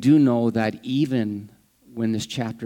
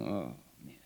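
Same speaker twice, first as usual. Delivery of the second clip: much softer, lower exasperated mutter; imagined Jesus, quietly exasperated; weary sigh; 9 semitones lower, 15 dB quieter than usual. Retold or imitated. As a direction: sigh it out under your breath. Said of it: "looking at Peter and thinking".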